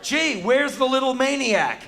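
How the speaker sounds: loud, high, hammed-up wondering; big stagey mock puzzlement; playful pretence. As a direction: pretend loudly you can't find the child. Pretends not to see hiding boy.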